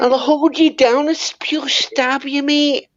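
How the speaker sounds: high-pitched exaggerated Scottish accent. Shown as a demonstration. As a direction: make a mock threat in a high Scottish accent. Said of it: "That's how you talk in Scottish".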